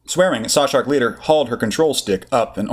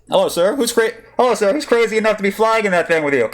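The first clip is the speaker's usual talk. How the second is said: cheeky pilot; 11 semitones higher than usual. Acted. Saw Shark pilot on comm.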